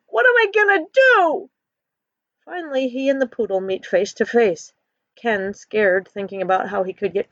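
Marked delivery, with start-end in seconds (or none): 0.1-1.5 high, loud cry of panic; desperate yell. A narrator's reading. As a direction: shout it in cornered panic. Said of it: "shivering, he yells".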